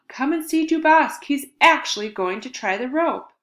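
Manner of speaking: high excited shriek, loud; high loud shriek of a taunting kid. Acted. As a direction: shriek it out to the whole class. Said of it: "Momo shrieked out".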